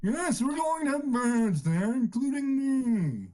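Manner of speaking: high, drawn-out yawny character voice; yawning voice. Acted. Cast member confirming who joins on Saturday, yawning voice. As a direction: stretch it out like a long yawn. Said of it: "Oh, yes, I'm yawning".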